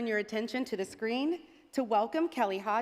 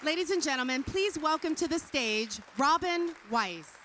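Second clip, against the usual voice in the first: announcer voice; 5 semitones higher than usual. Acted. Stage announcer introducing award recipient.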